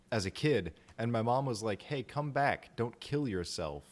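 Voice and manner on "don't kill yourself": usual speaking voice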